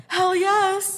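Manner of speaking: high, loud eager burst; reenacted fangirl enthusiasm; emphatic excitement. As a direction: blurt it out, thrilled. Eager fan answering her companion.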